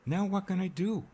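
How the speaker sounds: high-pitched helpless appeal; desperate. A narrator's reading. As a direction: throw up your hands in exasperation, voice climbing. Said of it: "he said desperately".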